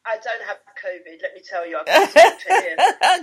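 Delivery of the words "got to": laughing through the words. Real, not acted. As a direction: break into a laugh mid-line. Laughing mid-sentence.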